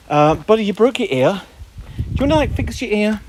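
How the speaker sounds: high, cooing talk to a pet. Real, not acted. Owner asks dog about his ear, baby talk. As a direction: pitch it right up for the dog.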